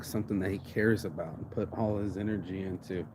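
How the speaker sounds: normal talking voice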